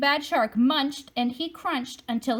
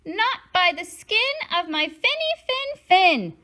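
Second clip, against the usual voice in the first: much higher, small clever voice; smart little fish; 9 semitones higher, 4 dB louder than usual. Acted. Smart fish answering the shark from the ship, high and small. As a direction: answer coolly, sure of safety. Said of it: "the smart fish replied".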